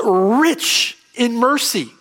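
"rich" drawn out slow and high; stress on RICH. Real, not acted. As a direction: lean hard on "rich", stretching it out. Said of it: "say it with more emphasis".

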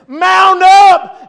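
Jesus rallying heaven, loud command; commanding shout. Acted. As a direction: call the army to ride out.

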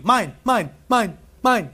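put-on playful child's mine, mine; imitating little kids. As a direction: mimic a grabby toddler. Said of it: "What do little kids do?".

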